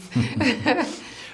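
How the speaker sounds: short high giggle; a quick amused laugh; light laugh. Real, not acted. Laughs after her story.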